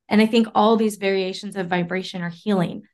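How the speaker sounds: ordinary delivery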